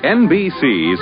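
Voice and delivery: ordinary delivery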